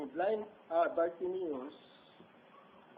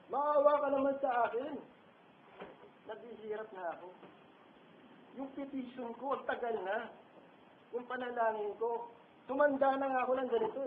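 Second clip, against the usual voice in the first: higher and louder, begging for pity; pleading cry; 6 semitones higher, 4 dB louder than usual. Retold or imitated. Preacher voicing a worn-out believer's loud lament. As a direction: cry out wearily to God, faster at the end. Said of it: "tayo sumisigaw".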